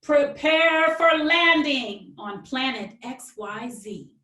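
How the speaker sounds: lively, expressive picture-book read-aloud; reading to children. Acted. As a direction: read it like story time for little kids. Author reads her picture book.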